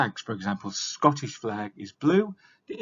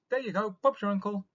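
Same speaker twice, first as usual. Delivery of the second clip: breezy, fast done-deal; 10 semitones higher, faster than usual. Shown as a demonstration.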